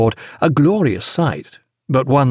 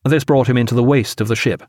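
ordinary delivery, nothing marked

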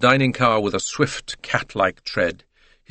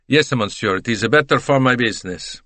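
put-on genial Italian-accented salesman; cheerful reply in an Italian accent; slight Italian accent; 3 semitones higher, 3 dB louder than usual. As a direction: explain it with an Italian lilt. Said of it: "with only a slight accent".

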